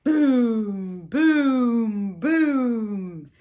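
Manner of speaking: loud booming thunder. Pretend. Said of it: "Mister Brown makes thunder".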